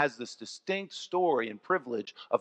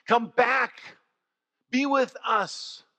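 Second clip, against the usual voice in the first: pleading call; 6 semitones higher, much slower than usual. Acted. Mourners calling loved ones back.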